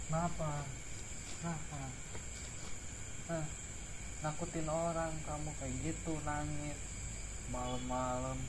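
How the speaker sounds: a gentle hushed voice, coaxing the ghost; hushed talk. Real, not acted. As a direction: talk softly to the ghost, close up. Speaks softly to the ghost.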